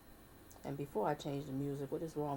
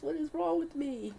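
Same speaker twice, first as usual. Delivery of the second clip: the question up high and teary, then falling; half-tearful self-reproach; 12 semitones higher than usual. Real, not acted. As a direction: scold yourself through tears. After tearful apology.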